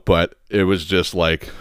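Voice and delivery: usual speaking voice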